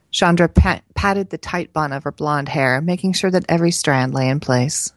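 ordinary delivery, nothing marked

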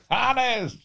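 repeating her high call across the yard. Retold or imitated. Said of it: "hat sie immer gerufen".

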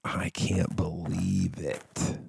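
slow, hushed whisper to himself; whispered gloat. Retold or imitated. Imagined Bon Iver secretly gloating, in a slow whisper.

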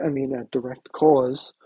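ordinary talk

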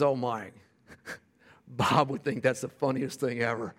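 low, soft retelling of tearful words; mildly imitated tearfulness. Tearful widow answers the pastor on the phone, lightly imitated crying. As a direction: gently suggest her tears, without sobbing. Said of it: "she starts crying".

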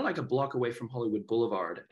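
ordinary delivery

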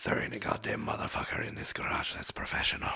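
low, whispery Irish menace; quiet Liam Neeson. Acted. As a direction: keep the threat hushed, Irish accent. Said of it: "this fucking quiet Liam Neeson".

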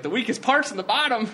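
high, loud TV-character quote; imitated TV meth head. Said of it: "and he's like".

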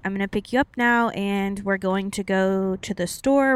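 ordinary talk

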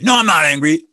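an angry person insisting they're not angry; defensive denial. Acted. Angry speaker brushing off the label, loud denial.

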